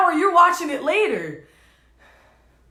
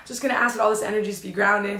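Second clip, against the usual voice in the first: low, soft, quick and choked up; steady yourself through tears; real emotion, rushed under the breath; dizzy and emotional; 6 semitones lower, 5 dB quieter, much faster than usual. Real, not acted. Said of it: "getting really dizzy and emotional".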